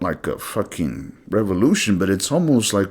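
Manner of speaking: usual speaking voice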